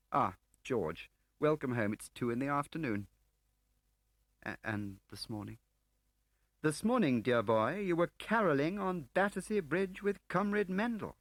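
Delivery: wry, breezy English officer; lightly voiced Gwillim.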